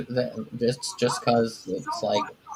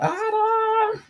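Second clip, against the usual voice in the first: mock scream, pitched way up; Roddy screaming. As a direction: imitate a high, drawn-out cry for someone. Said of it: "Roddy was screaming out".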